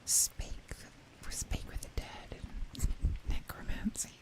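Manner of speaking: hushed sultry whisper; seductive whisper. Pretend. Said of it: "say things in, like, a seductive voice".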